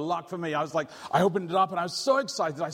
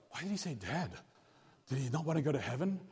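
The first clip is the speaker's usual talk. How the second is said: worried listener; 6 dB quieter than usual. Retold or imitated. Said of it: "you're like".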